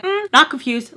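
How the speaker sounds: really loud. Retold or imitated. Telling him she was not confused, really loud. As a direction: raise voice and pitch to object. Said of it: "like, really loud".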